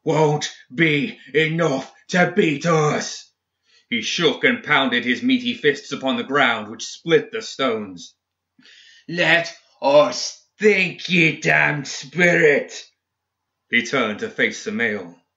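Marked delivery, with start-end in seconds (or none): 0.0-3.3 higher, slow, emphatic roar; defiant roar. A narrator's reading. Seamus pounding the ground against the spirit, roaring. 9.1-12.9 high, very slow, strained outburst; enraged roar. A narrator's reading. Seamus to the grave spirit in his mind, roaring in rage. Raging at spirit consuming him.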